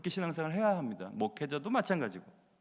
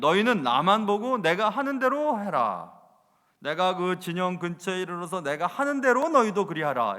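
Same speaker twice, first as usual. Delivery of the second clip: commanding order; 3 semitones higher, 7 dB louder than usual. Acted. Gideon ordering his three hundred men, loud and commanding. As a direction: bark it out like a general before battle.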